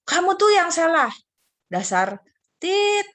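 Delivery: put-on high, loud kid taunting a rival; mock quarrel. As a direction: sneer it like a child in a fight. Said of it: "Lalu yang satu bilang".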